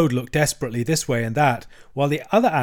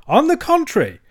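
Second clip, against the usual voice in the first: high, loud, defiant vow; emphatic; 5 semitones higher, 8 dB louder than usual. A narrator's reading. Toad vowing to take the next motor car, emphatic. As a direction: declare it loudly with swooping pitch. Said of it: "replied Toad emphatically".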